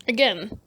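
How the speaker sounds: a real, shaky laugh through tears; teary laugh. Real, not acted. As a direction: laugh it off while crying.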